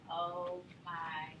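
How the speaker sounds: quietly imitating her own dread over the coughing baby; hushed dread. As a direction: let it fall out of you slowly as the danger dawns. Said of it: "I was like".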